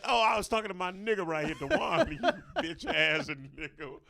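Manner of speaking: loud, high, laughing through the excuse; laughing cover-up. Retold or imitated. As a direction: fake innocence while cracking up. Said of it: "And I said".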